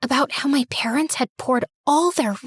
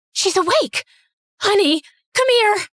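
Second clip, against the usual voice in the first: higher, louder excited shout across the room; excited calling out; 7 semitones higher, 4 dB louder than usual. Acted. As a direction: call out in joyful alarm. Mom calls out as daughter wakes.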